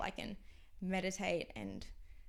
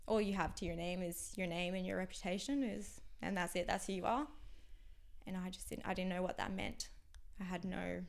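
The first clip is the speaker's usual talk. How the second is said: ordinary talk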